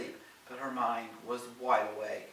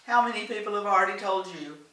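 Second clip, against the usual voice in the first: higher, louder scolding reminder; firm reminder; 6 semitones higher, 6 dB louder than usual. A narrator's reading. Doris reminds Helen to stay out of it, firm and raised. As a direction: shut the idea down emphatically. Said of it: "Doris reminded her".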